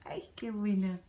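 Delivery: breathy, hushed "qué buena"; whisper. Real, not acted. Mother whispering over her baby's tasty apple. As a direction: whisper it with delight so as not to disturb him.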